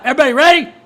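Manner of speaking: loud rising hype call; rally the crowd, loud; hype shout. Real, not acted. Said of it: "Let's get fired up".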